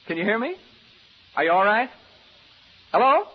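loud high call upstairs. Acted. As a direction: shout up to the doctor. Calling upstairs to Reed.

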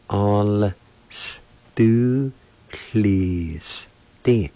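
"All s do please D" very slow, sounds held apart; slow syllable demo. Shown as a demonstration. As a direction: stretch out each piece of "All s do please D". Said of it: "like this".

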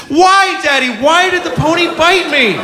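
put-on high, upset little girl. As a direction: ask it like a hurt seven-year-old. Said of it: "And she says".

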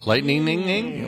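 singsong pile-up of "ning" endings; stuttering extra syllables. Pretend. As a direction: playfully repeat the "ning" of Lightning, climbing. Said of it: "shouldn't it be lightninging".

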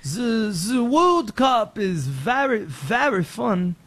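slow, hammed-up French accent; a mock French accent, drawn out slowly. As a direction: play a Frenchman gushing about the World Cup, French accent. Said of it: "Like France".

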